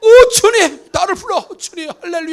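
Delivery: thrilled praise to the Lord; joyful cry. Pretend. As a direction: burst out in delight. Zacchaeus overjoyed called by Jesus.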